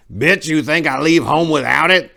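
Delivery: put-on loud, high, ornery old man snapping back; groaning old man. Old Apricot answering Rio, ornery high-pitched snap. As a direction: growl it out like a mean old coot. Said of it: "Old Apricot groaned".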